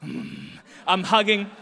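grudging hug. Retold or imitated. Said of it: "two siblings just like".

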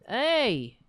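loud, sudden scold; sharp shout. Real, not acted. Host shouting at cat on his keyboard, sharp loud hey. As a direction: snap at the cat on the keys.

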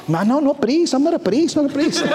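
put-on high, panicky Mexican-accented pleading; comic impression of a flustered Mexican man; imitated Mexican accent, broken English. Frightened father refusing the team leader, mock Mexican accent, high-pitched. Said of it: "spoke very little English".

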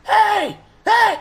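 high-pitched, loud mock holler; excited hollering. Movie recapper as man spotting a woman, excited hollering. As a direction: yell out, voice leaping way up.

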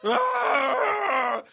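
long, loud, high mock scream; terrified scream. Man acting out his chimp nightmare, long terrified scream.

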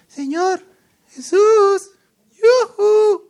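very high falsetto hailing; dainty mock calling. How we think the blind men called Jesus, mock falsetto. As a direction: call out in a silly, polite high sing-song. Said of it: "pensamos que los ciegos estaban".

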